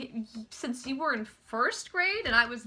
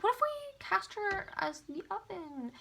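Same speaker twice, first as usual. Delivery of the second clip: higher, softer pitched suggestion; tentative brainwave; 6 semitones higher, 6 dB quieter than usual. Retold or imitated. Casting partners proposing an odd role, tentative and high. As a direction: float the idea lightly, high and soft. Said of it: "we were like".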